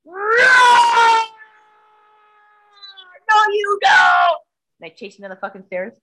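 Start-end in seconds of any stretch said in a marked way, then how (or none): 0.0-1.2 huge drawn-out shouted roar; lion roar. Retold or imitated. Woman imitating her own roar at the cat, lion roar. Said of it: "I roared like a lion". 3.3-4.4 very loud, high, stretched-out shout; lion-like roar. Retold or imitated. Woman re-enacting chasing her cat, roaring like a lion. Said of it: "I roared like a lion".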